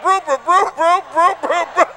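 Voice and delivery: mock stutter on "brew, brew"; imitating stutter. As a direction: stammer on "brew" trying to reach Bruce. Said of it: "he could never say Bruce".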